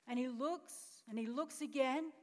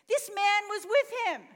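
loud, higher accusing call; accusing shout; 8 semitones higher, 9 dB louder than usual. Acted. Accuser in Bible scene.

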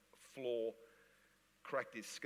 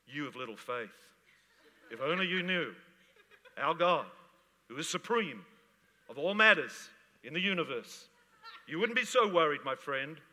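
louder and higher, smug laughing preacher; smug hyper-faith; 3 semitones higher, 10 dB louder than usual. Retold or imitated. Preacher mocking a smug faith healer, loud and smug. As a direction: lecture the doubter with smug superiority. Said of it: "Not smugness like".

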